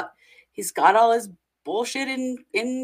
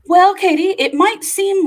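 excited; 12 semitones higher, 11 dB louder than usual. Real, not acted. Host hands off, big and bright.

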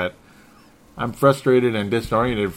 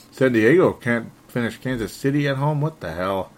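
ordinary talk

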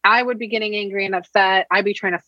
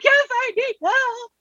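put-on much higher and slow, a small boy pleading; whiny kid voice; slower than usual. Mother imitating her son asking for help, high slow whine.

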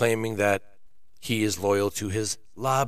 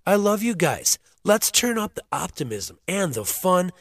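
loud, upbeat hype for the fans; excited; 7 semitones higher, 6 dB louder than usual. Real, not acted. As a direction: pump up the listeners. Hyping up before the music.

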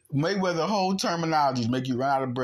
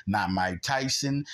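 ordinary talk, nothing marked

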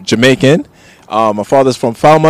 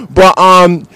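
ordinary talk, nothing marked

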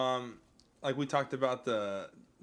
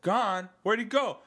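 high, loud incredulous question; mock alarm; 10 semitones higher, 6 dB louder than usual. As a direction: demand it like a startled commander. Acting the film's alarmed exchange.

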